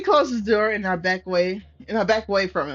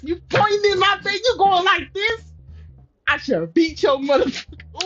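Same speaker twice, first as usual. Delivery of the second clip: angry outburst; 8 semitones higher than usual. Real, not acted. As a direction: snap back hard and high. Angry reaction to pointing.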